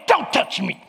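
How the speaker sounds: mock-angry warning, tight and sudden; imitating angry wife. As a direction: act out a wife warning her husband off. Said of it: "Oh, she's mad".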